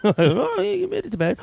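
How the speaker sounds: high sarcastic wife voice; lightly voiced sarcastic wife; teasing high reply; mock wife. Wife's sarcastic answer imitated.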